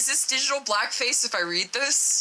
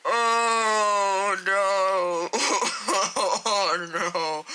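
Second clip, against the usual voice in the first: drawn-out oh no breaking into laughter; real helpless laughing; laughing dismay; much slower than usual. Real, not acted. Cracks up at the script.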